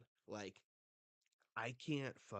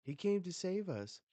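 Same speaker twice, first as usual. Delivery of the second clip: put-on much higher, louder child's exclamation; excited kids; 10 semitones higher, 10 dB louder than usual. Hostages think Mister Ya came for them, excitedly. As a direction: cry out as a hopeful kid, acted. Acted hostages greet rescuer.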